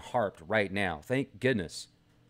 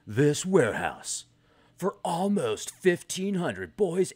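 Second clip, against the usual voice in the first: news read; 5 semitones higher than usual. Acted. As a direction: read it like a solemn news report. Reads the article aloud.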